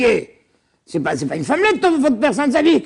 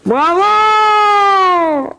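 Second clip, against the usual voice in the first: enthusiastic praise; 8 semitones higher, 4 dB louder than usual. Real, not acted. Praising a student's answer.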